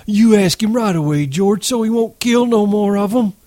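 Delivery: put-on high, urgent, childlike begging; excited pleading. A narrator's reading. Lenny urging George to save the pups, excitedly.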